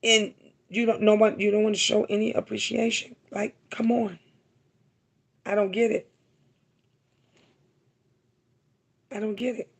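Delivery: hurt and withdrawn. Real, not acted. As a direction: say it almost to yourself, worn out.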